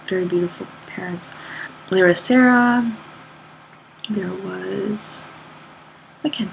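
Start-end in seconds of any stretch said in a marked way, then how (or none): 0.1-1.3 whispered aside. Real, not acted. Aside about the parents.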